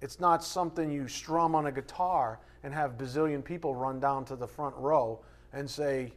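usual speaking voice

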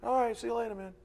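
high, offhand mock goodbye; casual parting. Preacher mimics treating a benediction as a parting, casual. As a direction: toss it off like a casual farewell. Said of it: "We treat it like a parting".

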